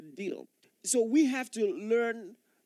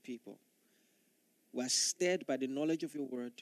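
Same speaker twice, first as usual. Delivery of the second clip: low, hushed prayer voice, dropping lower toward amen; softer closing prayer; 7 semitones lower, 5 dB quieter than usual. Acted. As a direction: pray it quietly and reverently. Closing prayer before the sermon.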